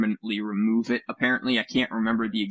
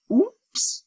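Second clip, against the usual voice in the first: high, cutesy fake oops; a cheeky fake apology; mock oops. Host mocking Microsoft's trick, high cutesy oops.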